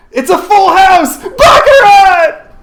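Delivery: high, loud, slow triumphant shout; ecstatic announcement. A narrator's reading. Narrator announcing the winning hand, ecstatic shout. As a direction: shout the win with a laugh.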